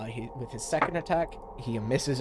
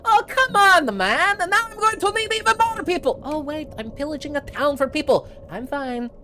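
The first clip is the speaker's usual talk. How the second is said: high, loud outburst settling into a shrug; exasperated protest; 7 dB louder than usual. Acted. Pirate watching the mage strike down his crew, exasperated. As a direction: protest loudly, then talk yourself down. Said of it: "He goes".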